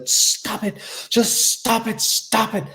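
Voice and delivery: high, mostly whispered plea from an overwhelmed mother; frantic mother. Retold or imitated. Mother at the end of her rope, frantic and breathless. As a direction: beg him to stop, sounding near breaking. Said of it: "she just, like, lost it. She was pulling at her hair".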